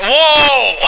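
high, loud startled shout; startled whoa. Real, not acted. Gamer reacting to a sudden play, loud high whoa. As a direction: shout in shock.

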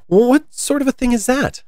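put-on high, curious young girl; a young girl asking, lifted high and curious; Alice's voice.

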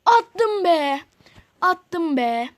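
loud, high "Attım be!"; triumphant shout. Real, not acted. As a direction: celebrate the goal. Scores a goal.

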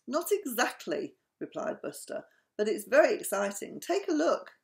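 high excited voice. Acted.

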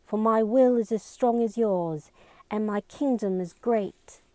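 reciting with resolve. A narrator's reading. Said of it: "She bit her lip and continued".

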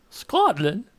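badly faked Scottish accent; poor Scottish accent. Listing parts of the United Kingdom, weak Scottish accent. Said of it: "that wasn't a very good Scottish".

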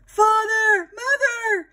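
put-on children calling high and loud from upstairs. Children calling their parents down from the attic, high loud calls. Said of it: "they called from the attic".

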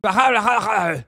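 loud throaty nonsense syllables; mock Welsh gibberish. Streamer asked for a Welsh accent, throaty mock gibberish. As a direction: gargle out fake Welsh sounds. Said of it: "Do a Welsh accent".

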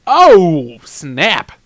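exaggerated cartoon exclamation; Mario. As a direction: belt out the first word. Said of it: "But he says".

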